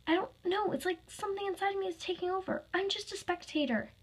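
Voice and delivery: high-pitched, anxious confession; acted rising panic; alarmed. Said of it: "he said in alarm".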